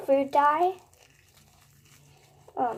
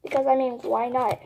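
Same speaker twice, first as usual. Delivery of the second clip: ordinary talk, nothing marked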